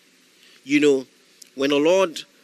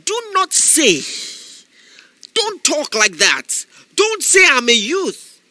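higher, louder shouted preaching; fiery preaching; 8 semitones higher, 3 dB louder, slower than usual. Acted. Preacher exhorting listeners not to make excuses, shouting. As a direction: preach it to the crowd, full force.

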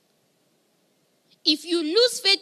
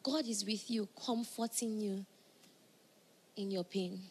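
soft, hushed reassurance; tender pastoral comfort; gentle comfort; 4 semitones lower, 9 dB quieter than usual. Real, not acted. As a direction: lower the voice to console.

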